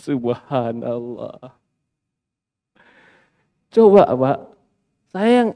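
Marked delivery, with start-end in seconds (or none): 0.1-1.6 slow, soft laugh of disbelief; soft amused sigh of subhanallah. Real, not acted. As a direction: chuckle the word out in amazement.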